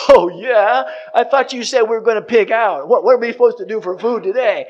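put-on loud, high kids' protest, a bit rushed; whiny complaint. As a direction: whine at Dad like let-down kids. Kids whine at their father.

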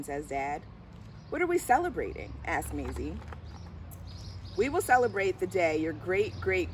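plain reading voice

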